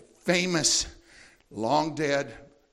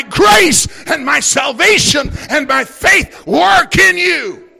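shouted, high preaching climax; fiery preacher shouting to the congregation; preaching at full force; 9 semitones higher, 11 dB louder than usual. Acted. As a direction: build the sermon to a roaring peak.

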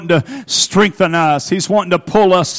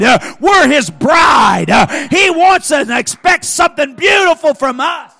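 loud, rhythmic sermon building higher; full-force preaching; 6 semitones higher, 8 dB louder than usual. Real, not acted. Preacher calls young people and parents to take a stand, rousing shouted preaching. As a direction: drive each declaration home in preacher's bursts.

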